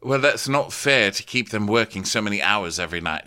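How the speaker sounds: ordinary delivery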